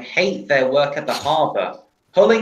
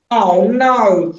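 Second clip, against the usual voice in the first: high, loud and slow "Oh no"; dismayed cry; 8 semitones higher, 4 dB louder, slower than usual. Acted. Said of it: "cried Diesel".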